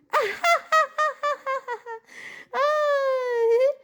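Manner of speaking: long high-pitched laughing fit ending in a squeal; laughing hard. Acted. Joker after her Vera punchline, high laughing fit. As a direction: laugh till it turns to a wail.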